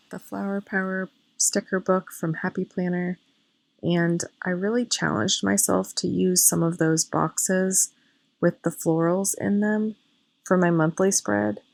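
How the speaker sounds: normal talking voice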